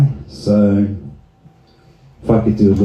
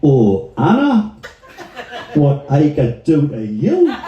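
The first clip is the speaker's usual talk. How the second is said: high, swooping Geordie seduction; hammed-up Geordie chat-up voice; Geordie accent; 11 semitones higher than usual. Shown as a demonstration. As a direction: lift line endings right up in broad Geordie. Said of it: "and Geordie".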